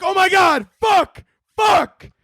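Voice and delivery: high, loud shout of alarm; mock yell of a mom crying out from downstairs; mom's panicked yell. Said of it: "she'll be like".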